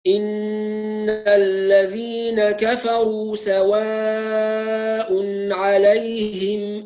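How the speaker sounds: very slow melodic tajwid chant; ترتيل قرآني بطيء; Quran recitation. Shown as a demonstration. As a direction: recite the verse slowly with full madd. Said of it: "أولًا لقالون".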